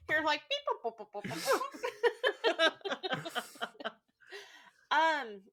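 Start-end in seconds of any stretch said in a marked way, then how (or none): 0.5-2.7 high beep boop noises; robot beeps. Retold or imitated. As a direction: chirp like a robot. Imitating robot theme music.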